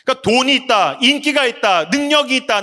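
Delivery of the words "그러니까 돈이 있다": usual speaking voice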